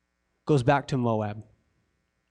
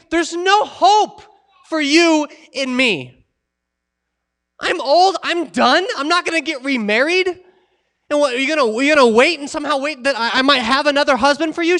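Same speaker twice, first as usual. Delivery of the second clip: exasperated Naomi paraphrase; 9 semitones higher, 5 dB louder than usual. Acted. Preacher voices Naomi pleading with daughters-in-law.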